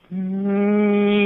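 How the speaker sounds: long high steady "mmm"; imitated scooter whine. Storyteller mimics the kid's scooter catching up, high steady whine. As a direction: hum a high, unbroken engine note.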